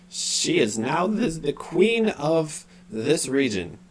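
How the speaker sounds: high, very slow entranced announcement; dazed, drawn-out proclamation; weird unconscious voice. Acted. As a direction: announce it as if mesmerised. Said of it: "unconscious quality to his voice".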